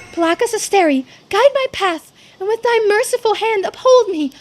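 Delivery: high, loud incantation; loud prayer to break the binding spell; fervent invocation. Acted. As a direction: call it out like a sacred chant. Girl chants a prayer to break binding.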